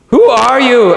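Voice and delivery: put-on high-pitched boy's voice; boy's urgent question. A narrator's reading. Shasta asking the lion who he is, high. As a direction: lift it high as the boy. Boy asks the lion in wonder.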